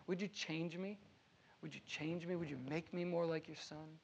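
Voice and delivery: a believer humbly asking God to change him; earnest quiet prayer. Pretend. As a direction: pray it softly, almost to yourself.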